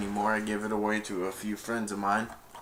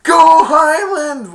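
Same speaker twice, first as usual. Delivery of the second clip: high, drawn-out shouted chant; victory cheer; 16 dB louder, much slower than usual. Retold or imitated. Dizzy after Highland wins, long screamed cheer. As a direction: cheer it at full volume.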